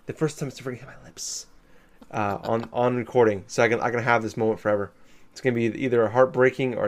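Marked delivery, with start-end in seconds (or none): 0.1-1.5 whispering. Real, not acted. About to taste beer.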